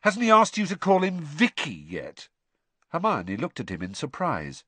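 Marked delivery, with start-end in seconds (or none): 0.0-2.3 high-pitched jealous needling; a higher, taunting question; mocking taunt. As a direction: tease with a spiteful edge. Ron taunts Hermione over Krum.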